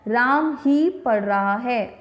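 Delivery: ही को ज़ोर देकर और धीरे बोलें; "राम ही" के बाद रुककर, ही पर बल; stress on ही. Shown as a demonstration. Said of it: "ही वाला जो शब्द है इस पे थोड़ा सा जोर". (ही शब्द पर ज़ोर, धीमी गति)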